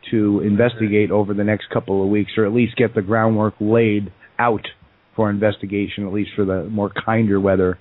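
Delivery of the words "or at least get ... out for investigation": normal talking voice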